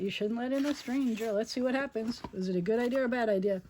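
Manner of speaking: plain reading voice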